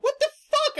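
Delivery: high, loud freaked-out shriek; startled yell. Retold or imitated. Retelling her scare at the robot call, startled yell. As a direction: burst out in alarm, far up in pitch.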